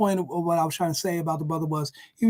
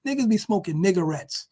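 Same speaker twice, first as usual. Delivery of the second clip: normal talking voice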